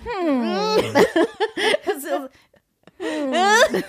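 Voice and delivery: high pouty hum, laughing; pouty hum demo. Shown as a demonstration. Showing her friend the pout, a pouty hum. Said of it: "like this".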